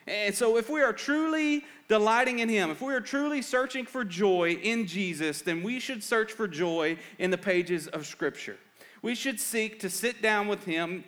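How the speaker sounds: high, forceful sermon voice; impassioned preaching. Real, not acted. Preaches with raised, urgent force.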